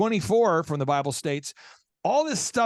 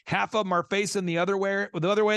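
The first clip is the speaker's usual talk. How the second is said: ordinary talk, nothing marked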